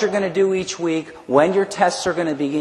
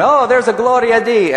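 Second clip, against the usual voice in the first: grandma's exclamation, higher and louder; imitating grandmother; 6 semitones higher, 6 dB louder than usual. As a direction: call it out like an old Italian grandmother. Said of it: "I'll never forget my grandmother going".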